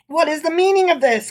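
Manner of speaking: startled rabbi bursting into the room; astonished cry. A narrator's reading. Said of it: "cried the rabbi in astonishment".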